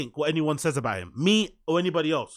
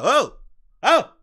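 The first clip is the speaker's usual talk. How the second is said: mock surprise; 7 semitones higher, 4 dB louder, slower than usual. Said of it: "He's like".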